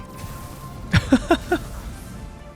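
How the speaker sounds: real amused laugh; breathy, high chuckle. Real, not acted. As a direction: laugh at what you just watched. Laughing at the trailer.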